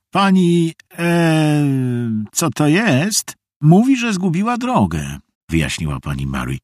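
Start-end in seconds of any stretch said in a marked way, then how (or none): 0.1-5.2 high, drawn-out hesitant explanation; hesitant explaining. A narrator's reading. Mother explains the strange guest to children, hesitant. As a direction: explain haltingly in a higher voice.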